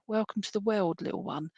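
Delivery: soft, tender hello to a newborn; hushed, loving voice for a little one; tender baby greeting. Retold or imitated. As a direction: welcome the baby gently. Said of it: "but you say".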